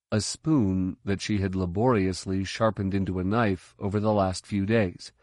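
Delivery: usual speaking voice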